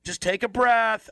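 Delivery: lightly voiced staffer calming a patient; soothing technician.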